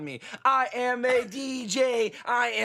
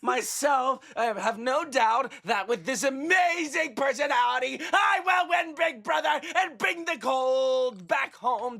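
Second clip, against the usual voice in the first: Jerry Lewis screaming; 2 semitones higher than usual. Pretend. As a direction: scream it like a vaudeville clown. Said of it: "so Jerry Lewis just screaming".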